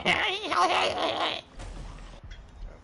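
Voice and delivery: put-on very high giggling baby laugh; baby giggle. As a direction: giggle like the Teletubbies sun baby. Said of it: "a baby just going".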